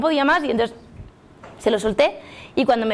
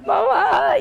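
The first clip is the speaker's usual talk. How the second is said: desperate call; 9 semitones higher than usual. Retold or imitated. Calling mother in despair.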